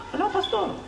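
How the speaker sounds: high, soft innocent answer; a lightly voiced shy pastor's reply; meek member's reply. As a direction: say it like a simple churchgoer puzzled by the question.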